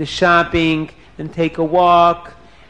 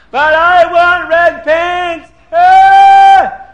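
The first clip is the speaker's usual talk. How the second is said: put-on slow, high, very loud child's wail; screaming tantrum; 13 semitones higher, 12 dB louder, much slower than usual. Spoiled prince demands pants from mommy, screaming tantrum. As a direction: scream the demand, ending in a shriek. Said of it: "he starts screaming for his red pants".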